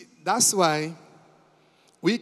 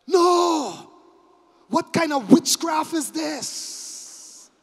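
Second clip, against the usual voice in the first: a high, loud cry of shock, then slowed; stunned brothers crying out; shocked disbelief; 6 semitones higher, 6 dB louder, much slower than usual. Acted. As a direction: gasp it out in amazement. Brothers react to the reveal.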